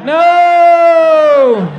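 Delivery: long, high, loud drawn-out no; hammed-up cry of denial; anguished shout. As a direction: wail it out in mock despair. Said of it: "And you're like".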